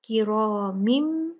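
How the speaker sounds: किरामिम drawn slowly, ending on meem with ghunna; slow recitation of किरामिम with nasal meem; meem instead of noon. Shown as a demonstration. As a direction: Quranic tajweed recitation. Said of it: "नून ना पढ़ें बल्कि मीम पढ़ें".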